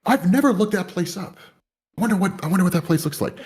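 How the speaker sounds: high, light musing of a new idea; curious wonder. Retold or imitated. Recalling wanting to look up Ghana, high curious wonder. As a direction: wonder aloud as if it just struck you. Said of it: "and I was like".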